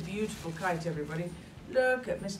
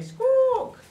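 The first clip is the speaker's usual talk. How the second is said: loud high bird squawk; parrot squawk; 10 dB louder than usual. Acted. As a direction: screech like a parrot. Wind takes kite away.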